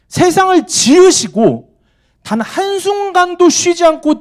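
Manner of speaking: fervent pulpit challenge; impassioned preaching. Acted. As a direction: press the congregation hard. Preaching at full force.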